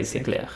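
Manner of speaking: c'est clair with CLAIR kept down, softly stated; reply c'est clair without raising the end; affirmative reply. Shown as a demonstration. Teacher modelling a reply, affirmative closed clair. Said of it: "So you can just reply".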